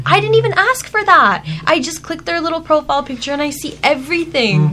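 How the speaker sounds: high-pitched, incredulous complaint; indignant disbelief. Real, not acted. Friend telling others about an AI summary, indignant.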